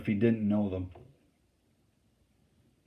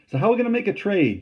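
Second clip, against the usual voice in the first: high, loud and fast call across the river; calling back; 11 semitones higher, 6 dB louder, faster than usual. A narrator's reading. Charlie calling back across the river to the rebel, loud, high and fast. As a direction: shout the question over the water. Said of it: "Charlie called back".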